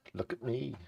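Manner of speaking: flirty show-off. Pretend. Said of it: "the males are a bit of flirts".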